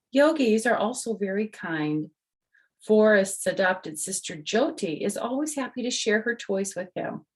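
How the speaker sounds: bright storybook reading voice; reading to children. Acted. The author reads her book to the kids, bright storytelling. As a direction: read it out to little listeners.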